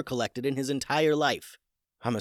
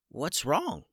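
a lightly higher little chicken voice; small chicken voice; 6 semitones higher than usual. A narrator's reading. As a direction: ask it like a small puzzled bird. Chicken first speaks.

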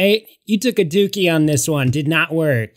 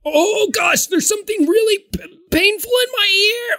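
high, whiny fake pain; high put-on ear whine; mock pained customer. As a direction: squeal about the pain in the ear. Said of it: "I learned this from someone".

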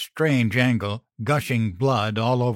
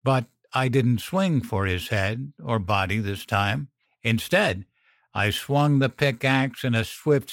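plain reading voice

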